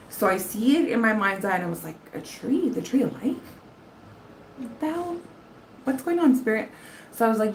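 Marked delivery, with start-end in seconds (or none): 2.1-6.7 confused surprise. Retold or imitated. Retold vision confusion.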